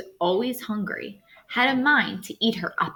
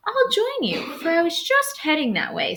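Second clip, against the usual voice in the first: much higher, falsely sweet wolf; sly wolf; 9 semitones higher than usual. Acted. Wolf tricks the girl into company, high and sweet. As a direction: trick her with a syrupy voice.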